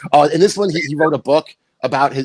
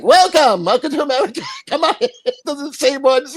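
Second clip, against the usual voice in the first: high, laughing mock welcome; mock welcoming idiots; 12 semitones higher than usual. Mocking the border greeters, a high laughing welcome. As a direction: greet the aliens like a gullible fool. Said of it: "idiots at the border who are like".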